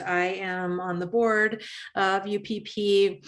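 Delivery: normal talking voice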